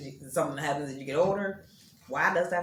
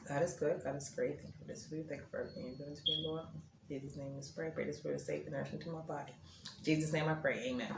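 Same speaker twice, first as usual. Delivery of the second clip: quiet prayer; 10 dB quieter, faster than usual. Real, not acted. Says grace over her food.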